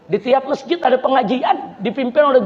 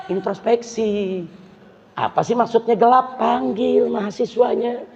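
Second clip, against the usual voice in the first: ordinary talk, nothing marked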